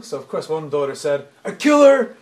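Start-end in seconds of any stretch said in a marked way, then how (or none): 1.4-2.2 higher and louder, outraged; fierce retort. Retold or imitated. Daughter telling father what she'd do, fiercely. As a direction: blurt it out like a fierce kid.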